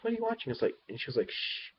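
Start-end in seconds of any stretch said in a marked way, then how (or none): 0.0-0.5 curious. Retold or imitated. Curious kid asking sister. 1.3-1.7 a sharp hushing shh; shushing. Retold or imitated. Sister hushing her.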